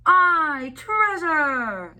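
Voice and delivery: high, loud pirate crew cheer; rowdy pirates cheering; crew shout. A narrator's reading. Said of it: "the others shouted".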